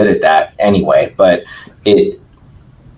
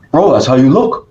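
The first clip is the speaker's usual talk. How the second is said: put-on Brazilian accent; 4 semitones higher than usual. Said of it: "he's got a thick Brazilian accent".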